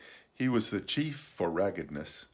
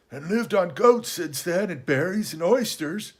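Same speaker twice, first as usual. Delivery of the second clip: high, hoarse castaway, wistful; plaintive old sea-dog, pitched well up; Ben Gunn; 9 semitones higher, 5 dB louder than usual. Acted. Castaway longing for cheese after years on goats, high and wistful.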